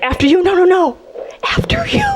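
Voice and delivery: rising into a squeaky high polite voice; sugary courtesy climbing up to falsetto; overly polite driver. Retold or imitated. Drivers yielding the road after nine eleven, squeaky politeness. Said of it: "It's like".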